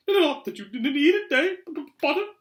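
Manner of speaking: high, loud, stuttering professor; nervous stammer. A narrator's reading. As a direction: stumble anxiously over every hard consonant. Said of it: "stammered Professor Quirrell".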